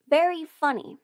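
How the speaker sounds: usual speaking voice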